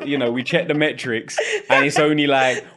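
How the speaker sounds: high, loud teasing through laughter; laughing tease. Real, not acted. Host teasing the guest about her accent, laughing. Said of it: "teasing through laughter".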